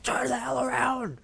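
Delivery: high, drawn-out mock scream. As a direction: yell at the screen in comic outrage. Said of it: "start screaming at the T V".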